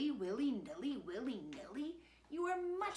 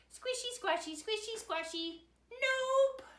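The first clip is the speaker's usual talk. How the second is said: high, loud defiant little piggy; piggy's high reply; 14 semitones higher, 6 dB louder than usual. A narrator's reading. To her daddy, the piggy refuses in a high squeal. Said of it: "But the piggy answered".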